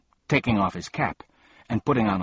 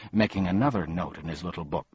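ordinary delivery, nothing marked

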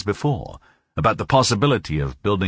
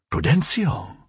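high rising exclamation of recognition; startled greeting to an unexpected visitor; exclaiming; 6 semitones higher than usual. A narrator's reading. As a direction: call the name upward in astonishment. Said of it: "he exclaimed".

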